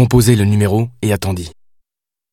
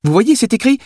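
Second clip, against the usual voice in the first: put-on high-pitched voice of a fictional young woman; insistent Billy; 12 semitones higher, faster than usual. A narrator's reading. Billy shows the writer proof in the book, insistent. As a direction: argue the point in a high character voice.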